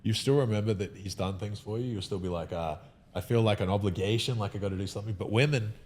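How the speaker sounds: normal talking voice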